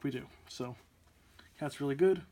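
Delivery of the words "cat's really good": ordinary talk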